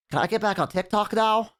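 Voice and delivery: put-on high, far kid voice whining; bored kid. Kid tired of mom's yearbook, high whiny voice. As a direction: sound like an impatient child.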